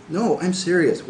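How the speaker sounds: no, really, I mean it; insistent. Acted. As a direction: press the point hard and slow. Insisting to friend Jay.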